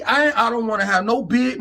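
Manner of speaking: ordinary talk, nothing marked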